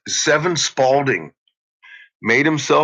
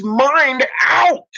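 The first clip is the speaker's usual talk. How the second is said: pitched way higher, rising into a near-cry; pleading frustration building to a break; worked-up rant. Real, not acted. Heated rant about fixing people.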